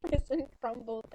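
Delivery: ordinary talk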